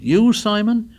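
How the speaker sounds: can't believe what he just heard; amazed. A narrator's reading. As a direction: leader stunned by a friend's words. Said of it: "Ralph stood up in amazement".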